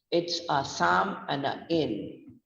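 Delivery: ordinary delivery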